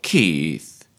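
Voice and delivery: pouty drawn-out call, sliding down low; sulky imitation of her calling Keith; Alison Steadman. Coach mimics the pouting character, long falling whine. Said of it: "the way she pouts".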